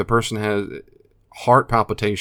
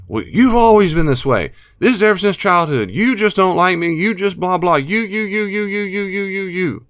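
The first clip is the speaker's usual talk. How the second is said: put-on high, loud childish blame; whiny ranting addict; 11 semitones higher, 6 dB louder than usual. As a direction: rant like a spoiled child. Addict ranting in role-play.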